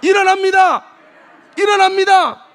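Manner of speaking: very loud, high repeated proclamation; full-volume call-and-response; shouted refrain. Shown as a demonstration. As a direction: drive the refrain home. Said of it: "다시 한번 크게 좀 해봐요".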